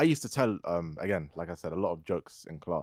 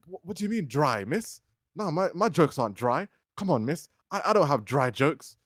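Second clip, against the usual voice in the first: offended; 7 semitones higher, 3 dB louder than usual. Retold or imitated. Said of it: "I got offended".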